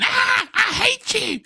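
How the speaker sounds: put-on high, slow, whiny child voice; squeaky hammed-up tantrum voice; mock kid whine. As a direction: stretch it out like a sulking kid. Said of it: "They're going".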